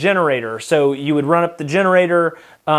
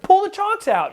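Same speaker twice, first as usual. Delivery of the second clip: very high mock-panic plea; panicked order. Retelling urgent order during the crash.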